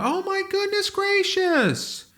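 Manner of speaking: very high, drawn-out fake shock; mock astonishment. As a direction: parody an owner stunned yet again. Mimicking owners shocked every time.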